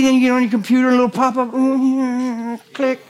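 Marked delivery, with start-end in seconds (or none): none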